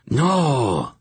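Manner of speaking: a high anguished wail of loss. A narrator's reading. Hierarch wailing over the shattered stone, high wail. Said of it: "the bald hierarch wailed".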